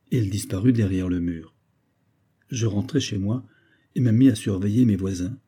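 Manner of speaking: ordinary delivery, nothing marked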